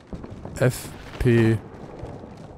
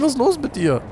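incredulous high; 13 semitones higher than usual. Real, not acted. Exasperated at teammate.

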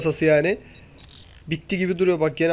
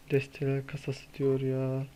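much softer, defeated aside; deflated mutter; 6 semitones lower, 13 dB quieter than usual. Real, not acted. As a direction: let the air out of it. Another deck crate, deflated. (hayal kırıklığıyla, kısık sesle)

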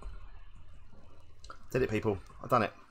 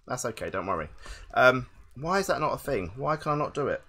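ordinary delivery

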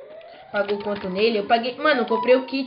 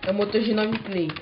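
ordinary talk

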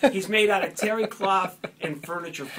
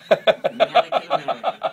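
higher, louder punchline repeat; 7 semitones higher, 10 dB louder than usual. Acted. Comic repeats the puppet's answer to the host, louder and higher. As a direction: hammer the joke home loudly.

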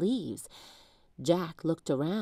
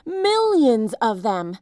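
slow, louder frightened cry; alarmed cry; 4 semitones higher, 8 dB louder, slower than usual. A narrator's reading. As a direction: stress the huge numbers in alarm. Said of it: "cried Jack".

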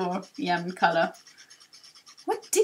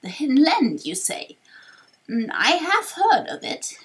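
slow, wavering elder with drawn-out words; wise old sage; 4 dB louder than usual. Acted. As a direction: play a kindly old sage pondering aloud. Voices old sage Torkoal.

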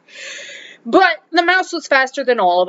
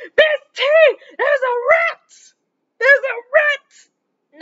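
much higher, slow panicky cries; panicked boy; 13 semitones higher, slower than usual. Retold or imitated. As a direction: imitate the big boy shrieking about a rat. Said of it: "And he's like".

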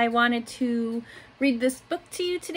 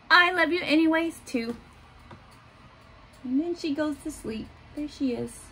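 put-on gentle picture-book reading for little kids; reading to children; 4 dB louder than usual. A narrator's reading. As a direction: read it like story time in class. Teacher reads picture book aloud.

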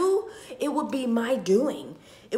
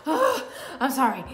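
real half-laugh, half-cry apology; apologizing while crying and laughing at once; laughing through tears; 3 semitones higher than usual. Real, not acted. Vlogger breaks down on camera, laughing through real tears. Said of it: "I am sharing my tears".

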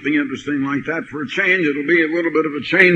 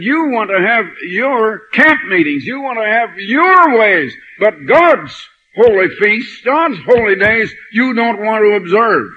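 much louder, higher, slow and pounding; forceful rebuke; 5 semitones higher, 6 dB louder than usual. Retold or imitated. Preacher retelling how he walloped the front-row minister, loud rebuke. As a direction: thunder it back at the man who set the trap. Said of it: "did I wallop that minister".

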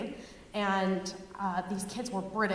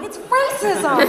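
mock gasp of realisation; 10 semitones higher, 9 dB louder, slower than usual.

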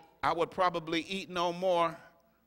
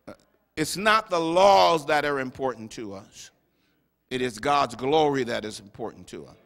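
normal talking voice

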